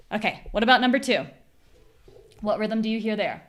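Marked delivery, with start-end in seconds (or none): none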